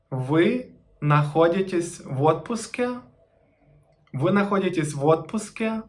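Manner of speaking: «Вы находитесь в отпуске» with a rising question tune on «отпуске»; question intonation. Shown as a demonstration. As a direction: pose it as a question by pitch alone. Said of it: "just to change the intonation".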